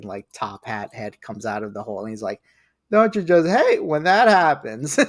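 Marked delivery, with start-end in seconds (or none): 2.9-5.0 high, loud mustache-twirling villain; Professor Loveless impression. Fan quoting a favorite villain scene to friends, loud high-pitched villain impression. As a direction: imitate a funny top-hat villain, pitched way up and louder. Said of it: "like, mustache twirling".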